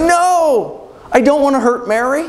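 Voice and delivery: very high, pleading protest; anguished refusal. Acted. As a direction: refuse with the pitch leaping way up. Joseph refuses the tempting voice.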